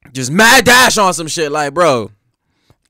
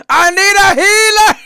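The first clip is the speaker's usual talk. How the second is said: very high, loud plea; hammed-up high-pitched shout; mock game cry; 6 dB louder than usual. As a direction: yell for help like a game character. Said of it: "Just remind me of all them damn games".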